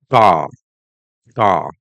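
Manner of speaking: CAR as 'cah' with no R; firm, open 'cah'; silent R. Shown as a demonstration. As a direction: leave the R off car. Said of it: "R is not pronounced".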